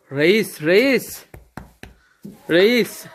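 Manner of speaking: high calling to the calf; calling a pet. Real, not acted. Calls the bull calf. (buzağıya tiz sesle seslenme)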